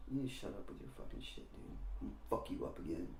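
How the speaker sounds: very soft, mostly whispered threat; muttered threat. Retold or imitated. Girl to beaten boy in principal's office, muttered threat. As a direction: threaten him under your breath.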